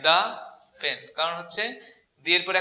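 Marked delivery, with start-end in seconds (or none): 0.1-1.0 "the pen" as DAA, pause, pen; thuh before consonant. Shown as a demonstration. Teacher giving the answer, "the pen" as daa pen. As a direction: split "the pen", article with an open vowel. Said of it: "কনসোনেন্টের আগে বসতে হলে হবে দা".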